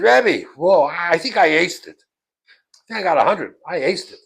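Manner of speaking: loud, eager schoolboy claim; excited boast. Retold or imitated. Pupil telling rebbe he did great, excited. Kid boasts of his test result.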